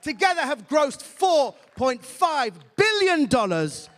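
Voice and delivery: ordinary talk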